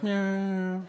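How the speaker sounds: high mock cat meow; playful imitated meow. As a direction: purr out a little meow at him. Senator meows.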